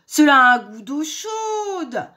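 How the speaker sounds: high, loud, drawn-out complaint; spat-out disgust. A narrator's reading. Wolf tasting hot-water soup, loud high disgust. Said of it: "cracha-t-il".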